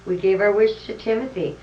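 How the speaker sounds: berries speak up loud; a cheerful shout from the berries. Acted. Berries answering Santa.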